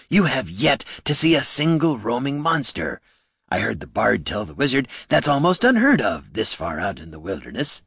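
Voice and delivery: troll prompting eagerly, pitch swinging up. Acted. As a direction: plead your case as a troll.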